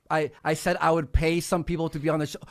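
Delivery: usual speaking voice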